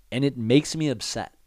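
ordinary delivery

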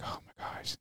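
unvoiced, breathy worry under the breath; nervous whisper. Waiting outside the colonel's office, nervous whisper. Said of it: "I'm like".

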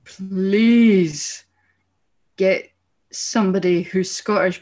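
loud, emphatic plea, dragging out the second please; exasperated pleading. Retold or imitated. Viewer dreading a bad Scottish accent on TV, pleading loudly. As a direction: beg loudly, stretching the words in dread. Voiced thought begging for real Scottish casting.

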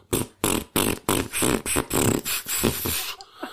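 rhythmic burst of lip-made farts; imitated fart noises. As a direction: sputter a fart on every running step. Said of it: "every time my foot touched the hill, I was going".

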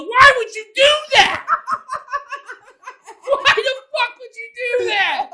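very high, loud laughing yell; outraged shout. Retold or imitated. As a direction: scream it in disbelief. Outraged at girls answering phone.

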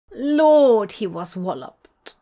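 usual speaking voice

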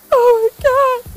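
hammed-up sob of "oh my God"; mock ugly crying. Wife crying over the show, high slow wail. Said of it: "I'm ugly crying".

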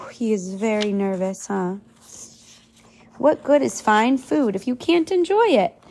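usual speaking voice

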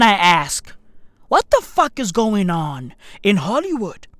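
usual speaking voice

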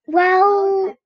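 drawn-out well, then giving in; relenting. A narrator's reading. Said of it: "I said relentingly".

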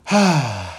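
long, audible exhaled sigh; frustrated sigh. Real, not acted. Borrower venting about needing patience, frustrated sigh. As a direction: let out a weary sigh of frustration.

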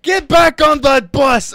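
slow, high shouted command; imitated yelling. Border guard orders traveler onto the bus, yelling. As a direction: holler the order, stretching each word. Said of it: "this guy keeps on yelling".

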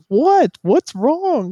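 put-on high, startled young son's voice; confused kid. Son asking his cursing dad what's wrong, high and startled. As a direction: pitch way up like a baffled boy.

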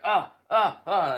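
loud, high panicked oh oh oh; panicked gasps. Retold or imitated. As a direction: freak out in a panic. Retold panic when stuck.

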